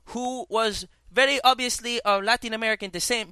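high-pitched mock Latin American accent. Describing a firearms trainer to a co-host, mock Latin American accent. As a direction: imitate a Latin American military man's accent. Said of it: "because he talked like this".

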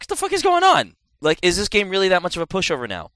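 blurting out disbelief at a pushover boss; incredulous outburst. Retold or imitated. Gamer retelling his shock at an easy boss fight, incredulous.

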